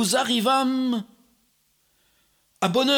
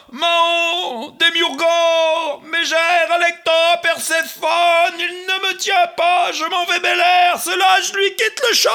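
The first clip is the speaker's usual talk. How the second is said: terrified shriek; 7 semitones higher, 6 dB louder than usual. Acted. The horrified devil flees the field, shrieking. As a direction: howl in terror as you flee. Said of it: "s'écria".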